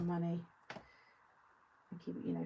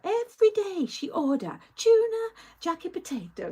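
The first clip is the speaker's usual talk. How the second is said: much higher and louder, hammed-up Turkish waiter; loud flamboyant waiter; 12 semitones higher, 12 dB louder than usual. Waiter teasing her about her daily order, loud and flamboyant. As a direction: belt it out like a showy waiter teasing a regular. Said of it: "quite loud and, like, flamboyant".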